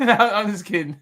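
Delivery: laughing through the words; real, amused laughter. Real, not acted. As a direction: crack up while taking back the joke. Laughs while taking back the joke.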